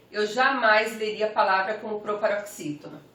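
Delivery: ordinary delivery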